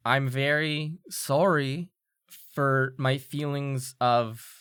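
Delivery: very slow, halting delivery with pauses; halting forced apology. Acted. Student made to apologize to the one she glares at, stiffly halting. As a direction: drag out each phrase of a stiff apology.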